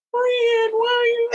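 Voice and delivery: high tearful giggle; laughing bewildered friend. Retold or imitated. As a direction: ask it half-laughing, half-crying. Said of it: "She's looking like".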